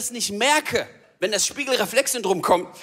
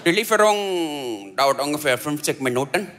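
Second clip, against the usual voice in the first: put-on Indian accent; 6 semitones lower than usual. Restaurant worker answering after a long pause, Indian accent. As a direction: draw it out as an Indian order-taker. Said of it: "Dann sagt er".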